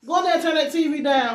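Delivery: loud high call across the house. Real, not acted. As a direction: yell the order to the next room. Calling to child across house.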